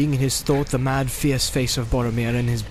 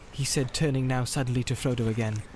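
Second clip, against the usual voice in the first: usual speaking voice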